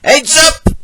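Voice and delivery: high, loud shout of warning; warning call. A narrator's reading. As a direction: call out sharply from above. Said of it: "he called".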